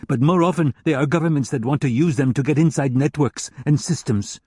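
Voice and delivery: a hint of a contemptuous German hacker lecturing. German hacker explains market.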